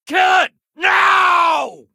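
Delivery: full-force, high-pitched shouted order; combat shout. Acted. Officer ordering troops to kill a creature, shouting. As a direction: yell the kill order over a fight.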